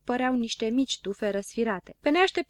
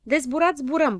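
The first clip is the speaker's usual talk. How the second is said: raised, grumbling concession; 6 semitones higher, 6 dB louder than usual. A narrator's reading. Don Dănel doubts the balloon trip, grumbling. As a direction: grumble along reluctantly. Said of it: "bombăni Don Dănel".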